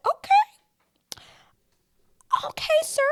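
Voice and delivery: high, squeaky "okay, sir"; squeaky teasing okay; high mock surprise. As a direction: raise the pitch in playful disbelief. Reacting to Joey's kiss.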